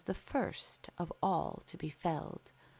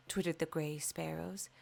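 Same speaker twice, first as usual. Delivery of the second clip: ordinary delivery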